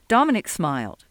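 ordinary delivery, nothing marked

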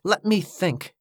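ordinary delivery, nothing marked

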